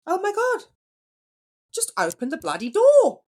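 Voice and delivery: high, loud mock Australian accent; attempted Australian accent. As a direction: have a playful go at an Australian accent. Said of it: "in a fabulous Australian accent".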